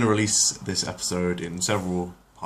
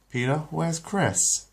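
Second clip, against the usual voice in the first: Lois voice; 9 semitones higher, slower than usual. Acted. Lois asks husband.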